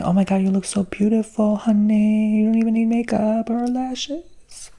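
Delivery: playing a fawning friend; gushing admirer. People complimenting you, quoted.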